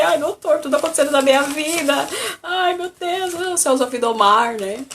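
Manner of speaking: high, rushed, panicky pleading; patient pleading with the doctor, high and panicky; anxious plea. Retold or imitated. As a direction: beg the doctor, near tears. She voices herself panicking to doctor.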